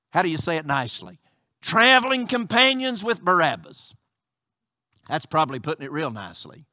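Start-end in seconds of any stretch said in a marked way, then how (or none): 0.1-1.2 fast, softer, lower throwaway aside; wry aside. Real, not acted. Preacher joking to the congregation, quick wry aside. 5.1-6.6 fast, softer, lower throwaway aside; wry aside. Real, not acted. Preacher joking to the congregation, quick wry aside.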